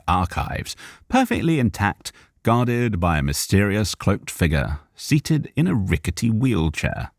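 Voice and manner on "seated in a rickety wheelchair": ordinary delivery, nothing marked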